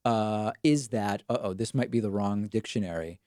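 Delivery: ordinary talk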